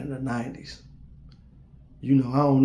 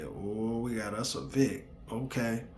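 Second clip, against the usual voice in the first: ordinary talk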